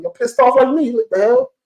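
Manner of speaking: high-pitched what-the-hell rant; exasperated outburst. Real, not acted. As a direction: voice jumps high in frustrated disbelief. Fans angry over the loss.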